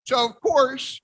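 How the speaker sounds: usual speaking voice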